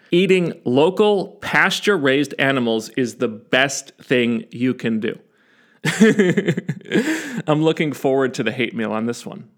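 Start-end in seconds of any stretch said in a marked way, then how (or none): 5.8-7.4 short high laugh; quick amused laugh. Real, not acted. Laughs at own claim.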